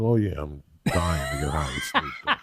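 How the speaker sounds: low, laughing mock enthusiasm; dripping with sarcasm, chuckling; sarcastic mock eagerness.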